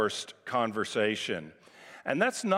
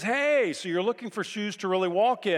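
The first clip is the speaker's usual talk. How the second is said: pushy stranger; 8 semitones higher than usual. Retold or imitated. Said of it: "he says".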